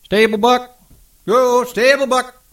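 high call from far off; distant call. A narrator's reading. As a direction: call out across the yard. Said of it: "came a clear call".

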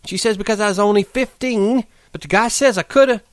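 high, rushing dreamy account; eager outpouring. Acted. Curly's wife pours out her past to Lenny, high and eager. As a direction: pour out her dream before he leaves. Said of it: "her words tumbled out in a passion".

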